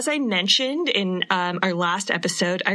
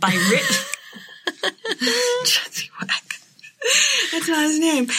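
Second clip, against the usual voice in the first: laughing through words; 9 semitones higher than usual. Real, not acted. Cracking up over a silly name.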